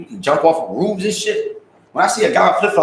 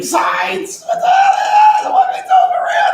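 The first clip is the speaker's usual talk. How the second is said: high laughing shriek; laughing squeal; 5 dB louder than usual. Real, not acted. Streamer cracking up at his own gang-sign joke, shrieking.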